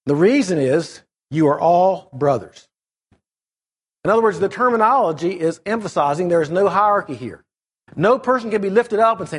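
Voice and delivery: normal talking voice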